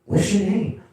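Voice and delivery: high, lightly voiced woman asking; mock woman caller. Woman caller asking his name. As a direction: ask like an eager caller.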